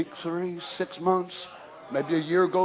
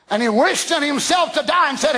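full-force preaching; 8 semitones higher than usual. Acted. Preacher shouts to the church about the gene of God. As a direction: preach it out to the congregation.

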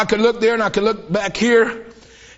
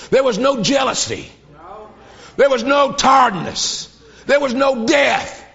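building preaching; slower than usual. Real, not acted. Preacher describing heaven to church, building to a shout. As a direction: climb each line toward a shout.

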